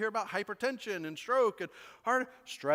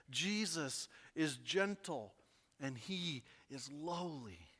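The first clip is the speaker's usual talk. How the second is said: slow, softer, dropping to a hush; tender reassurance; 4 semitones lower, 7 dB quieter, slower than usual. Real, not acted. Pastor reassuring the stressed congregation, slow and soft. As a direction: comfort them, slow and soft.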